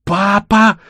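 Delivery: put-on high, loud child's cry; frantic scream. A narrator's reading. Girl sees her father fall, screaming frantically. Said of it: "истошно закричала Алина".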